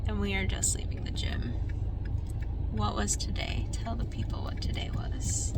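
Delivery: soft unvoiced whisper. Real, not acted. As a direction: hush it like it's late. Whispering at night after gym.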